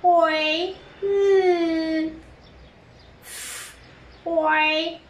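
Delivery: Oil split into long oy, then a held l; sounded out. Shown as a demonstration. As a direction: hold each sound of Oil separately. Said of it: "أوي".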